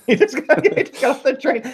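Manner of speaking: high, laughing repeat of the joke. Pretend. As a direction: giggle through the punchline. Echoes joke, much higher.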